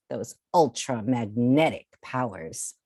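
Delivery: plain reading voice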